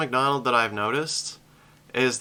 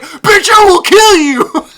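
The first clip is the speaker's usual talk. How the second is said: laughing mock threat, much higher and louder; mock furious shout; 15 dB louder, slower than usual. Poet answers a heckler who says he sucks, with a laughing mock furious yell. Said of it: "and be like".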